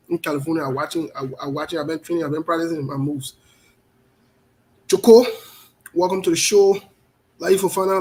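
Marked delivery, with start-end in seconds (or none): 4.9-8.0 loud welcome. Real, not acted.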